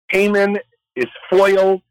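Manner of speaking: usual speaking voice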